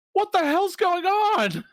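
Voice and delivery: high, loud, drawn-out yell as Coxo; alarmed shout. Acted. Coxo bursting in on the wrecked party, alarmed shout. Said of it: "and he's like".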